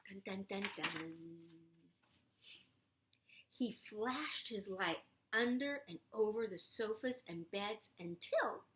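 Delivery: ordinary delivery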